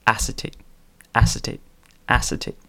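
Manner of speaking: lightly imitated Google text-to-speech. Google reading out a word, lightly mimicked text-to-speech. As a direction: repeat it identically, like a computer readout. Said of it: "he had Google, um, text to speech".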